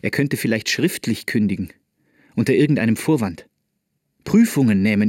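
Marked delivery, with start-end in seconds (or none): none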